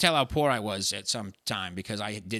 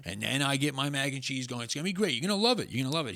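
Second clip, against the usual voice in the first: talking it up; 3 semitones higher than usual. Retold or imitated. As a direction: imitate a roux braggart hyping his dish. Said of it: "He was like Donald Trump".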